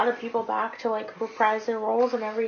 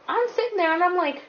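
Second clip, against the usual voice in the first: baffled outburst; 5 semitones lower, 9 dB louder, faster than usual. Retold or imitated. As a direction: blurt it out, baffled, laughing at yourself. Acts out puzzlement at seeing kid.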